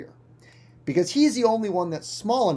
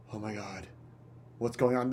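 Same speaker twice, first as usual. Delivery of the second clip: stunned hush; 6 semitones lower, 10 dB quieter, faster than usual. Acted. Friend stunned.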